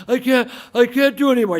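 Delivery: high, loud strained whine; exhausted protest. Acted. Client to trainer mid push-ups, acted exhausted protest. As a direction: plead as if your strength is gone.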